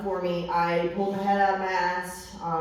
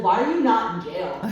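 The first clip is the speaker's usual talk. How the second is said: laughing quote of son; 6 dB louder than usual. Retold or imitated. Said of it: "He said".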